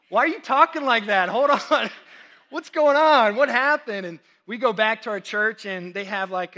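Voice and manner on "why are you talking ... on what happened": loud, high, baffled outburst; comic alarm. Retold or imitated. Pastor to his suddenly drawling students, comically alarmed. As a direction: exclaim in exaggerated disbelief, half laughing.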